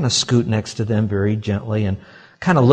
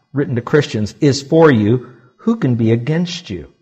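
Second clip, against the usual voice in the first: ordinary talk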